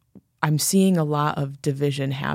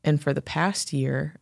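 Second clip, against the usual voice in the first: ordinary talk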